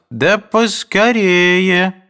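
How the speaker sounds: high, loud, very slow urging call; impatient shout. A narrator's reading. Mother impatiently calls for an answer, loud. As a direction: hurry them, calling across the house. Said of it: "кричала мать".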